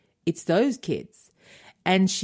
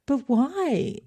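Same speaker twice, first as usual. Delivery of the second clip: higher-pitched, puzzled question; puzzled protest; 7 semitones higher than usual. Retold or imitated. Mother asking her daughter why she says American, puzzled. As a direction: retell a mother's mildly baffled challenge.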